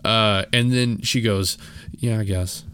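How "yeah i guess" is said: sullen reply. Retold or imitated. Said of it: "Just like that".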